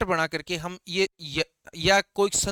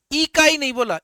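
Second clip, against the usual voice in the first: इकाई with the final ई hit loud and high; stress on last ई; 6 semitones higher, 6 dB louder than usual. Shown as a demonstration. Teacher contrasting a wrong reading for the class, pushing loud. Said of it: "last वाले ई पर जोर ज्यादा".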